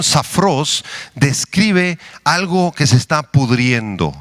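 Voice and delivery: normal talking voice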